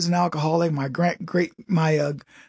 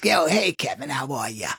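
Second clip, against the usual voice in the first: smoker lady voice; 4 semitones lower than usual. Retold or imitated. Smoker lady greeting Kevin, deep and low. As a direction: growl it like a lifelong smoker. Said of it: "if a lady talks like this".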